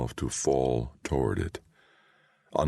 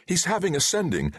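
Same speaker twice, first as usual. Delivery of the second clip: high urgent cry; a courtier cries out as the lord collapses; cried out. A narrator's reading. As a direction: call it out in alarm. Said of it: "another voice cried".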